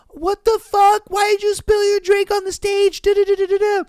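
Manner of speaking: high, loud imitation of an outraged dancer; mock angry yelling. Dancer yells at man over spilled drink, mock angry. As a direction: scold in a high voice, tailing into rattled-off gibberish. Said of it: "started yelling at the guy".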